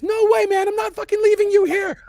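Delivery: high, loud defiant yell; Korea refusing. Acted. Game master voices a marine who won't leave, defiant shout. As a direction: shout back, refusing to abandon a comrade. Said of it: "Korea just says".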